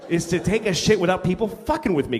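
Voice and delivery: ordinary talk, nothing marked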